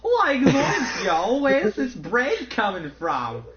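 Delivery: Shaggy voice. Acted. Friend riffing on the Jesus-as-Shaggy joke, loud cartoon voice. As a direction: yell it out like a goofy cartoon slacker.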